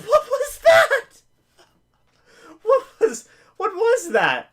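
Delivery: very high, loud laughing cry of disbelief. Real, not acted. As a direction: laugh out the horror of the play. Reacting to a batted pass.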